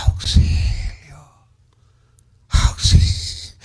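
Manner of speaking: voiceless whispered cry for help; a faint, voiceless cry from a dying man. Acted. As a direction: barely breathe out the plea as strength fails. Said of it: "Ya se está muriendo".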